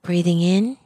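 calm, unhurried meditation guide, long pauses between phrases; measured mindfulness-teacher delivery with lingering silences; guided meditation. Acted. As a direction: guide listeners gently into their breathing, leaving space after each phrase. Leads a breathing meditation.

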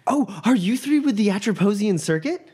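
put-on high, polite young woman; a nervous, eager student caught off guard; satyr student. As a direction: greet them timidly from her chair.

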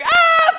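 high, loud mock scream with a laugh; shrieking ah, laughing through it; retold scream. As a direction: scream like the spider just appeared, half laughing. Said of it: "We all start screaming".

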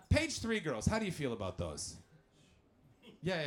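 ordinary talk

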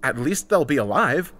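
emphatic comeback; countering. A narrator's reading. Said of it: "I countered".

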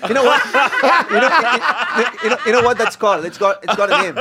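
high excited laughing. Real, not acted. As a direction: burst out laughing while asking.

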